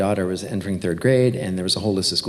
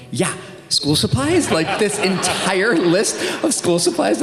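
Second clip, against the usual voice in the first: pointed hint; 9 semitones higher, slower than usual. Retold or imitated. Hinting at the manager for help.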